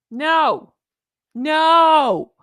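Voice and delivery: loud, drawn-out protest; outraged no. Real, not acted. Reacting to the clip about Princess Markle, outraged no.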